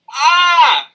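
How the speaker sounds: high loud shriek of fright; terrified scream. Acted. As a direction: shriek at the ghost. Sees Banquo's ghost.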